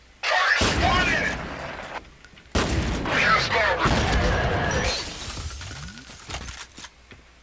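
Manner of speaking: long, loud, high burst of laughter. Real, not acted. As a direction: crack up hard and keep laughing.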